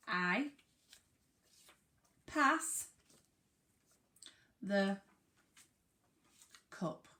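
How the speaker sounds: slow, each word set apart with pauses. Shown as a demonstration. Teacher reading a sentence to the class, word by word. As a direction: read it one word at a time. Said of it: "I've got a sentence and it says".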